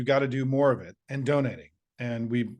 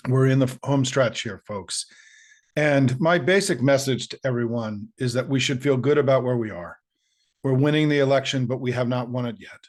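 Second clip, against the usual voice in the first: louder rally-style campaign delivery; 4 dB louder than usual. Real, not acted. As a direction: pump up the volunteers like a stump speech. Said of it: "campaign voice".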